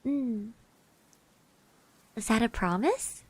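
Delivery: ordinary talk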